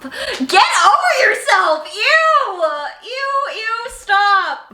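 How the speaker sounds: high, loud cringing yells; ew, cringe-shouting at the page. Real, not acted. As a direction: yell at your younger self in disgust. Cringing at own old diary.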